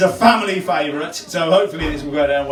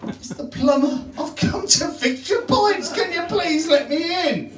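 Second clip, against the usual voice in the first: plumber pleading; 12 semitones higher, slower than usual. Acted.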